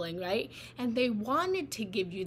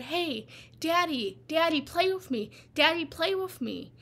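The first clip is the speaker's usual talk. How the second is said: put-on higher, louder kid begging Daddy; pleading child; 6 semitones higher, 4 dB louder than usual. Child begs busy Daddy to play, pleading. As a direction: call out insistently like a little kid. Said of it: "when we were a child and we said".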